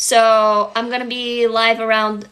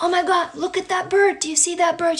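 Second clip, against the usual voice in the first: higher, delighted pointing out the bird; excited parent; 6 semitones higher than usual. Retold or imitated. Parent showing an imagined child a bird, delighted. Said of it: "And I'd be like".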